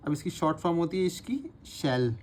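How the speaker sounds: ordinary talk, nothing marked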